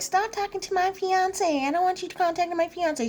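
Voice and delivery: high-pitched mocking imitation of a jealous partner; silly high scolding voice; mock demand. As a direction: pitch it way up, ridiculing the complaint. Mocks a jealous fiancé confronting her.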